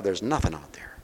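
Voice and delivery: hushed whisper to close the thought. Real, not acted. Preacher to a grieving listener about empty marriages, whispering. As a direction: drop to a breathy whisper.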